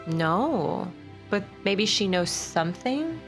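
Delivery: normal talking voice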